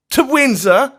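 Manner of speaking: high, loud shrieking question. Acted. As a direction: shriek in horror at the idea. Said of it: "he cried with a shriek".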